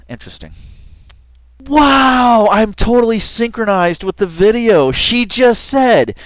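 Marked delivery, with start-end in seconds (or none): 1.7-6.2 excited. Real, not acted. Amazed at syncing with video.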